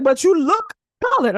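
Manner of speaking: high, loud stubborn stranger voice; imitating stranger. Stranger abroad insists she looks colored, high and stubborn. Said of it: "He's like".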